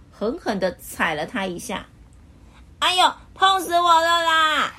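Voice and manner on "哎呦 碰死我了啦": high, loud yelp of pain; pained cry. Acted. Mr. Happy crying out after Mr. Grumpy stamps on his foot. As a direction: yell as your foot is stamped on. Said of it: "快乐先生痛苦地叫起来了".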